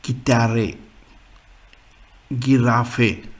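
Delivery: "Gitarre" and "Giraffe" with hard g and final "eh"; pronuncia tedesca. Shown as a demonstration. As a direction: say "Gitarre, Giraffe" as German words. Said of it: "So in German was".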